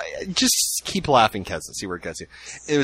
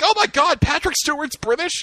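shocked realization. Retold or imitated. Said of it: "I'm like".

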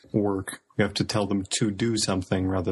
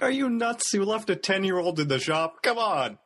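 high, exasperated disbelief; incredulous scolding; 11 semitones higher than usual. Acted. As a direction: scold a brother who has blundered. Acts George scolding Ron in disbelief.

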